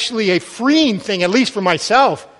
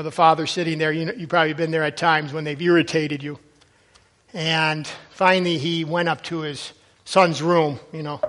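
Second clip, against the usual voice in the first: usual speaking voice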